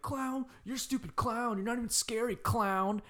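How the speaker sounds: high taunting voice; mock jeering kids. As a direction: sneer it like a bully. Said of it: "they're like".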